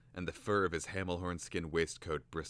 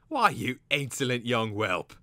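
loud, raised outraged bird; angry shout; 6 semitones higher, 9 dB louder than usual. A narrator's reading. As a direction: shout down the insolent youngster. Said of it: "the cater bird shouted angrily".

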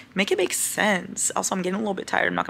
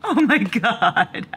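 delighted gasp; 4 semitones higher, 4 dB louder, much slower than usual. Real, not acted. Seeing cat in tunnel bed.

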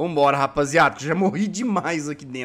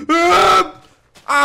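startled yell; 10 semitones higher, 6 dB louder than usual. Real, not acted. Startled by monsters in game.

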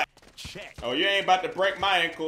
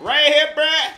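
louder and higher, calling out; calling for pass; 7 semitones higher, 8 dB louder than usual. Real, not acted. Calling to a teammate for the ball, loud call.

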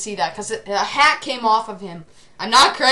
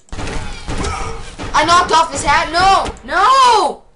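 gamer shout; 7 semitones higher, 5 dB louder, much slower than usual. Real, not acted. Misses the shot, cries out.

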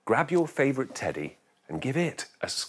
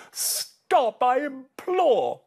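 put-on old lady crying out; 9 semitones higher, 3 dB louder than usual. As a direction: wail it like a frazzled old lady. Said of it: "The little old lady cried".

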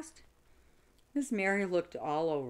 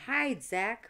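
higher, louder urgent call; urgent shout; 9 semitones higher, 5 dB louder than usual. A narrator's reading. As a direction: hurry him into hiding. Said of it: "Ryan shouted".

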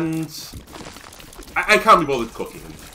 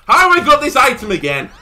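loud, high exasperated question; higher and louder, fed up; exasperated outburst; 12 semitones higher, 9 dB louder than usual. Real, not acted. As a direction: blurt it out in real disbelief. Annoyed at game item.

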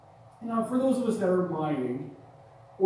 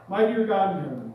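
salvation prayer; 5 dB louder than usual. Real, not acted. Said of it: "Everybody repeat after me".

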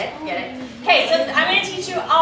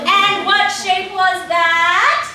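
loud, high, very slow cue; cue line; 6 semitones higher, 7 dB louder, much slower than usual. Shown as a demonstration. Director cueing the whole group to shout, loud slow question. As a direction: call it out slowly, rising at the end.

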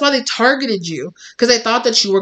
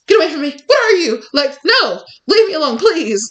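mock terrified plea; 9 semitones higher than usual. Frightened attacker to the client, high hammed-up panic. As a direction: beg to be left alone, hammed-up fear. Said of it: "this person is like".

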